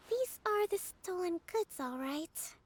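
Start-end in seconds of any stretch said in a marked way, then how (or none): none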